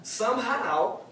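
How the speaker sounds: normal talking voice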